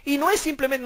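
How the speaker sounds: ordinary delivery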